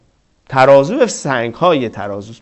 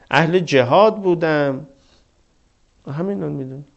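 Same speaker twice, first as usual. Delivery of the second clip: humble plea to God; 6 semitones lower, 6 dB quieter than usual. Acted. Man pleading before God.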